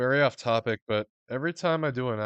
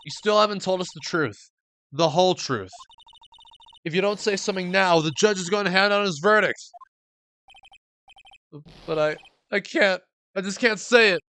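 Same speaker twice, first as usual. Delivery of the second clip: higher, urgent pleading voice; pleading defense attorney; 8 semitones higher, 8 dB louder than usual. Acted. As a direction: press him hard, then falter.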